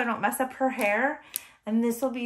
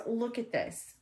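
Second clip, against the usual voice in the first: ordinary talk, nothing marked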